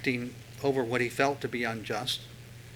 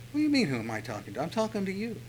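indignant retort; 5 semitones higher, 4 dB quieter, faster than usual. Retold or imitated. Micah confronting the guy.

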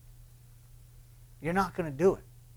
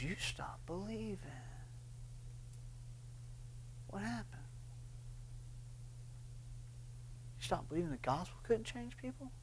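whispered rebuke; 3 semitones higher, 19 dB quieter than usual. Acted. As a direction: breathe it like a voice in your ear.

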